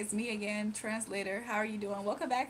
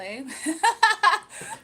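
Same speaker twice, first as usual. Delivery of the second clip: high burst of laughter; 7 semitones higher, 12 dB louder than usual. Real, not acted. Laughing at name confusion.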